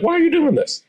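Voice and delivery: high-pitched mock bewilderment; puzzled partner. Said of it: "the partner looks at you like".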